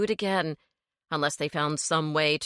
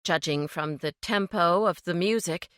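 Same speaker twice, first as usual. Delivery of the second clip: ordinary delivery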